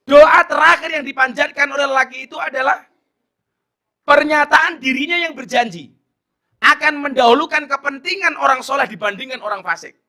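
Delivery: high, loud sermon shout; fiery preacher's climax; preaching at full force. Acted. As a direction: boom it out to the crowd. Preacher booming the point.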